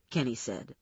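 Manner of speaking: ordinary delivery, nothing marked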